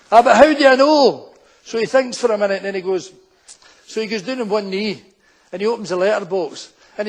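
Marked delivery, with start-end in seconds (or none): 0.1-1.3 loud, raised-pitch doubting yell; insistent shout. Acted. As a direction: keep challenging through the closed door. Suspicious woman insists through door.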